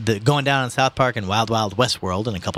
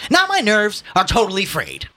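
high, loud theatrical complaint; overwrought; 12 semitones higher, 5 dB louder than usual. A narrator's reading. Son watching the struggle, overwrought. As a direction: lament it in a raised, high voice. Ignatius dramatically distressed.

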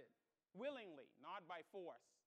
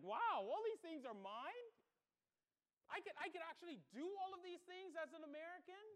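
amazed, pitched up and louder; retold excitement; 5 semitones higher, 4 dB louder than usual. New citizen learning what is now his, retold excitement. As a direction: relive the wide-eyed thrill of finding out. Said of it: "I started getting excited".

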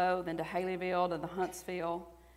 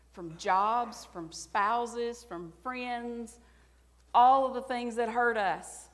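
rising, louder preaching; impassioned preacher, climbing higher and louder; preaching at full force; 5 semitones higher, 5 dB louder than usual. Real, not acted. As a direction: build it up to a passionate cry.

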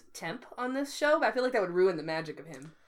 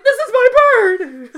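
high, loud, laughing yell; indignant shout; 17 dB louder, slower than usual. Retold or imitated. Darren retold, shouting.

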